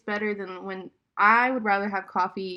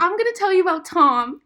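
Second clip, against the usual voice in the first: excited; 8 semitones higher, 8 dB louder than usual. Real, not acted. Excited intro to story.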